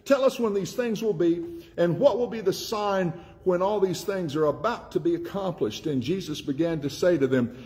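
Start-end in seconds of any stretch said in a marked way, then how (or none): 0.0-5.9 high, eager questioning voice of the disciples; disciples asking. Acted. Preacher voicing the disciples asking Jesus, high and eager. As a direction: lift the pitch and plead the question.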